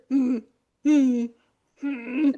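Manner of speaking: short closed-mouth grunts; imitated grunting. Picturing him sitting there, mock grunting. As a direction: grunt three times, with gaps between. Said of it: "like, grunting and".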